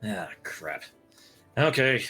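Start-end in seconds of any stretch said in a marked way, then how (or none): none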